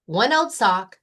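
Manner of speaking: ordinary delivery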